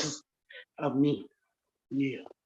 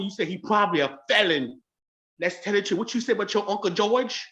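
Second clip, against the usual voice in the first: normal talking voice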